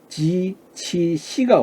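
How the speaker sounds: 지, 치 drawn out slowly; pinyin initials. Shown as a demonstration. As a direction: spell 지 치 시 slowly. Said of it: "요 세 개가 올 때".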